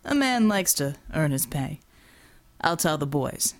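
a hint of a beefy thug's voice, low; Borovo.

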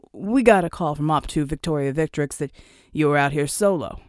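low-voiced soldier reporting in; big team leader.

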